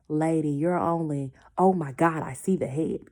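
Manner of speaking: loud, startled break-off; shocked nurse. Retold or imitated. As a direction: stop mid-sentence in shock. Nurse sees the baby's head.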